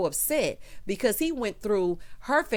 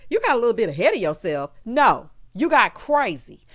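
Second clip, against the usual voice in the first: loud, indignant scolding; 7 dB louder than usual. Real, not acted. Vlogger scolding the fiancé for his excuse, louder. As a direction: tell him off.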